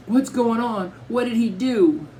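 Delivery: put-on high, anxious child's voice; jumpy little sister. A narrator's reading. Little sister grabs her brother's arm, jumpy and high-pitched. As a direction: pitch it up high, alarmed questions. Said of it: "starting to get jumpy".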